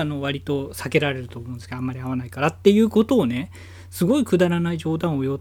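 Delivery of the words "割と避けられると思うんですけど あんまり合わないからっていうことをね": ordinary delivery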